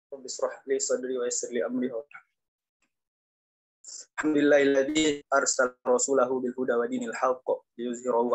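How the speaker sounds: reciting an Arabic prayer in a measured voice; Arabic recitation. Acted. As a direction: chant it like an opening prayer.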